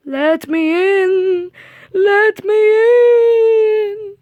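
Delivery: high, slow wailing ghost; spooky ghost voice. Acted. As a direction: drag the words out like a haunting spirit. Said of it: "said Percy in a spooky voice".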